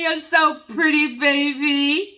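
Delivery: high, laughing sweet-talk; teasing lover. Acted. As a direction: coo it at her through a laugh, teasing. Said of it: "He's laughing".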